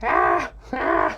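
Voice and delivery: raspy cawing crow; low harsh caws like a baby crow; crow impression. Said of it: "It's my crow impression".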